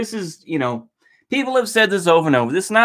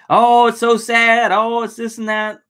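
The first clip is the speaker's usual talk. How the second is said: drawn-out, higher mock lament; mock sympathy; 7 semitones higher, slower than usual. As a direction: imitate people's hollow sympathy, higher and stretched out. Mocking people's hollow sympathy.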